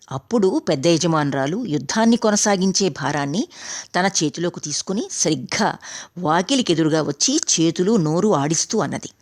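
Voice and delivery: plain reading voice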